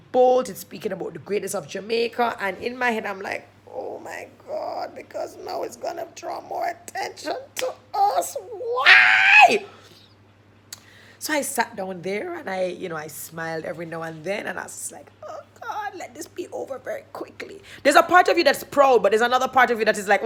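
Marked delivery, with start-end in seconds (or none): 3.7-8.4 high, strained whisper-whine; panicked inner thought. Retold or imitated. Storyteller voicing private dread at the video, panicked whisper. 8.4-9.6 high, loud, drawn-out cry; anguished mock wail. Storyteller dreading more attention, anguished wail. 15.3-17.6 high, hushed little plea; pleading inner thought. Retold or imitated. Storyteller silently begging for the video to end, hushed plea. Silent prayer while sitting.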